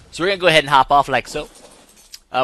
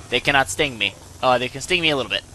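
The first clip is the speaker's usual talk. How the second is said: ordinary delivery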